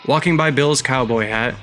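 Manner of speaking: ordinary delivery, nothing marked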